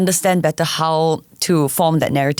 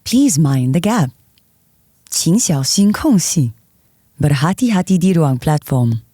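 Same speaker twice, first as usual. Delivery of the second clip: MRT train announcement. Shown as a demonstration. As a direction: read it like the train PA. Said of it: "this is what it sounds like".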